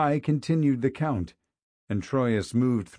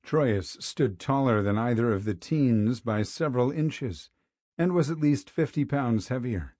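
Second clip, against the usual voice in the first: ordinary delivery, nothing marked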